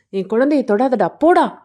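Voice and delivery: loud cry rising to a high yell; furious protective shout. A narrator's reading. Mother defends her child from an intruder, furious shout. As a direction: build to an explosive shout.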